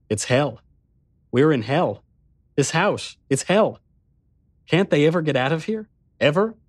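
distressed, rising in pitch toward the end; growing plea, mildly breaking. A narrator's reading. Narrator realizing the house is hell, voice close to breaking. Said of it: "my voice breaking".

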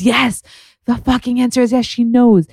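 high, emphatic and thrilled; excited reveal. Real, not acted. Excitedly reveals that she knows.

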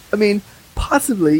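usual speaking voice

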